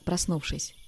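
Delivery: ordinary delivery, nothing marked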